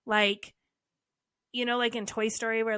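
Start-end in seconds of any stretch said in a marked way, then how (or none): none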